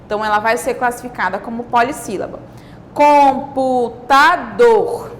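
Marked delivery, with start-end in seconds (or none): 2.9-5.1 COMPUTADOR very slowly as com, pu, ta, dor; four separate, emphatic beats on COMPUTADOR; syllable by syllable. Shown as a demonstration. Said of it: "quatro também".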